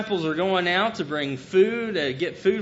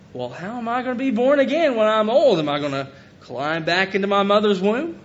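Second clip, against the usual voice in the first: Nicodemus, incredulous; 4 semitones higher, 5 dB louder than usual. Acted. Nicodemus questioning Jesus, acted.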